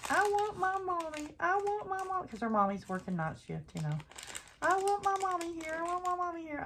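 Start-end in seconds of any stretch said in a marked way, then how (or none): 0.1-2.3 put-on high-pitched whining little girl; whiny child. Granddaughter crying at bedtime, whining high child voice. 4.6-6.6 put-on high-pitched whining little girl; whiny child. Granddaughter crying at bedtime, whining high child voice.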